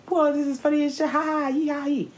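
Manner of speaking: high, quick mock-giddy outburst; giddy high squeal, imitating oneself high; retold giddy glee. Retelling being high to a friend, giddy glee. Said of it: "Everything is hilarious".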